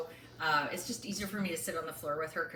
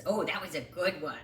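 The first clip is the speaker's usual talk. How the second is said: usual speaking voice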